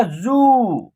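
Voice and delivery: जू with the ऊ held; long vowel. Shown as a demonstration. Teacher showing ज with the matra, जू held long. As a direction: draw out the vowel in जू. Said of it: "आप long तरीके से उच्चारण".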